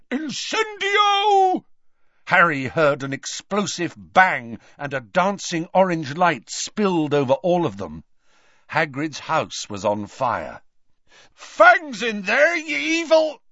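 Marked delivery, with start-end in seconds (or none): 0.1-1.6 very high, long-drawn battle yell; yelled spell. A narrator's reading. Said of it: "Death Eater behind him yelled". 11.3-13.4 high, drawn-out bellow; Hagrid. A narrator's reading. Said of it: "Hagrid bellowed".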